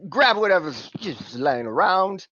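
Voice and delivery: lightly voiced cockney fixer; Simon's cockney voice. GM as Simon pitching the job, lightly cockney. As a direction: haggle in a cocky cockney lilt.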